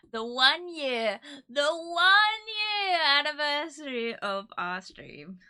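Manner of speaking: loud, slow, drawn-out proclamation; grand celebratory announcement. Real, not acted. Streamer announcing the one year anniversary, grand and celebratory. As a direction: swell up big and stretch out each word.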